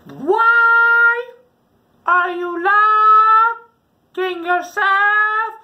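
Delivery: very high, loud, slow sung question; eerie sing-song sister. Retold or imitated. Sister at the locked door, crooning eerily. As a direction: croon it like a creepy horror sister. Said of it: "She opens my door and says".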